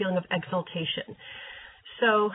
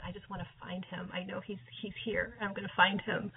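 soft whisper, fading away; intimate whisper; 8 dB quieter than usual. Retold or imitated. Seeker sensing Jesus nearby, whispered. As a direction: whisper as if he's close by.

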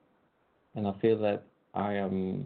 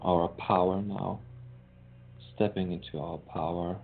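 guided meditation. Acted. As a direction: ease listeners into calm.

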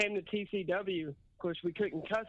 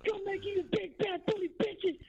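wrestling promo; 13 semitones higher than usual. Retold or imitated. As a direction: ham up a pro wrestler interview. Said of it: "and he's like".

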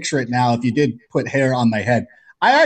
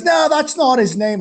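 Australian guy; 11 semitones higher than usual. Retold or imitated. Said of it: "He goes".

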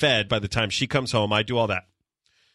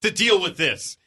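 high, slow, emphatic mock demand; exasperated wife; 11 semitones higher, slower than usual. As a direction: imitate a wife at the end of her rope. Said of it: "and she goes".

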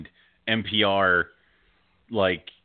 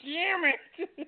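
very high-pitched mock cursing; falsetto-high pretend frustration; mock exasperation; 3 dB quieter than usual. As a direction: squeak out a playful curse. Co-host's mock exclamation at story.

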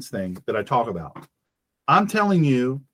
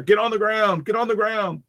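barked commands, raised and higher; police yelling; 6 semitones higher than usual. Retold or imitated. Host acting out police yelling at armed shopper, mock shout. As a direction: cop ordering a suspect down. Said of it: "Gets yelled at, screamed at".

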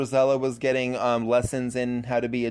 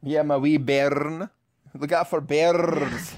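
put-on Scottish accent; 2 semitones higher, slower than usual. Scottish voice continuing the bit.

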